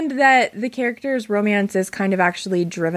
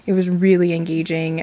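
normal talking voice

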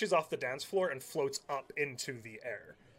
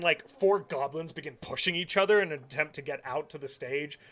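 ordinary talk, nothing marked

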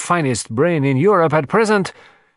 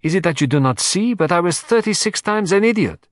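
higher and quicker, self-reproaching Poirot; animated; 5 semitones higher, 3 dB louder than usual. Acted. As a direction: burst out with animated frustration. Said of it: "becoming suddenly animated".

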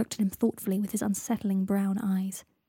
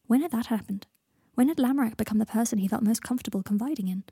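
plain reading voice

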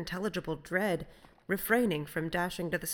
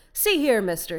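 loud, higher challenge to a stranger; challenging sailor; 5 semitones higher, 10 dB louder than usual. A narrator's reading. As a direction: call out suspiciously across the water. Challenging a suspicious stranger at sea.

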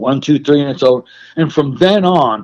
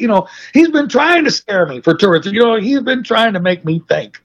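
usual speaking voice

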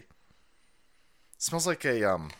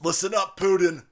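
mock Biden calling Putin; 2 semitones higher, 6 dB louder, slower than usual. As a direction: bluster at Putin. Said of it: "gonna call Putin and say".

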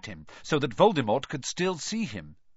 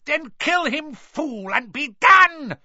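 screeching, higher and louder; screeched; 12 semitones higher, 7 dB louder than usual. A narrator's reading. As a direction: screech the command in fury. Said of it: "screeched Voldemort".

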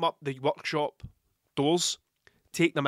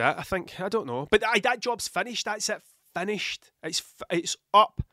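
normal talking voice

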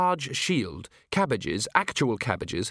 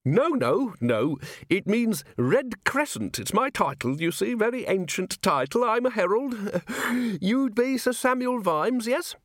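put-on high, eager little old man; fussy old herald; 10 semitones higher than usual. Herald explaining his ancient title, high fussy voice. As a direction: chuckle and prattle in a high voice.

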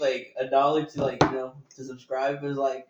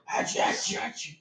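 imitating sneezes; 3 dB quieter than usual. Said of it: "He just goes".

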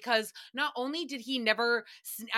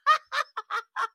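high, loud burst of laughter; 12 semitones higher, 6 dB louder than usual. Real, not acted. Laughing at own story.

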